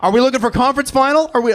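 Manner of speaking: auctioneer. Pretend. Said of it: "I feel like an auctioneer".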